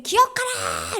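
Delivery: high, drawn-out acted call; God's command. God ordering forgetful people to remember, high drawn-out call. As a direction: proclaim it from on high. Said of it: "하나님이".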